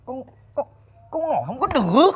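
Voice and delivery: a son stammering to his mother, short of breath; halting, breathless stammer. Acted. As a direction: gasp out the words in pieces. Son stammers breathlessly about symptoms.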